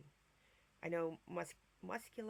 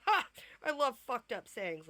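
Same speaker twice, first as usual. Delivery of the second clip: mock delighted exclamation; 6 semitones higher, 11 dB louder than usual. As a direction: bark it out like you love it. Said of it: "I'm just like".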